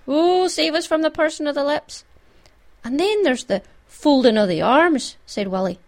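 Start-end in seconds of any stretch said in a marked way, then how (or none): 0.1-2.0 high-pitched collective moan; moaned cry for rescue; moaning plea. A narrator's reading. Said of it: "A moan went up". 2.8-5.2 slow, higher, ominous warning; slow dread, voice lifting; scaring himself. A narrator's reading. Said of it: "he was even scaring himself".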